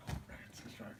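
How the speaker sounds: breathy defeated whisper; whispered disappointment. Retold or imitated. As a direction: concede under your breath. Defeated again.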